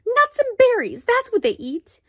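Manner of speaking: high, loud shocked exclamation; exclaiming in alarm. A narrator's reading. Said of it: "Homley exclaim".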